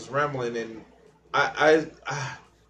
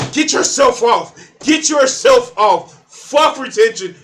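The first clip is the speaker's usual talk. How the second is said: much higher, loud, slow yell; shouted; 7 semitones higher, 10 dB louder than usual. Acted. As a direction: bellow it like a rally chant. Shouted advice, then repeated plainly.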